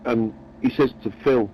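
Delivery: ordinary delivery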